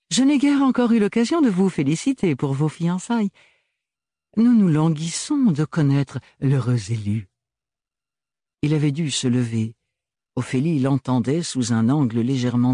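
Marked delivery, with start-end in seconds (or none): 0.1-7.3 high, sweet, insincere courtesy; needling the rival; teasing Archibald. Acted. Archibald taquine Thorn.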